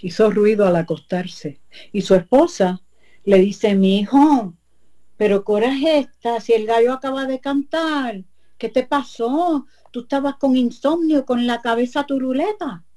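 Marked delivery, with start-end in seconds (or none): 3.7-12.8 lightly higher voice of the wife, scolding a little; a hint of a suspicious wife; wife's voice. La esposa voiced in story. (la esposa regaña al marido con voz algo más aguda)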